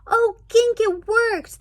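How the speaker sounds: high, loud gasp of delight; excited girl. Acted. Dory gasping with joy to Gink, high and loud. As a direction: burst out with joy. Dory sees the plan worked.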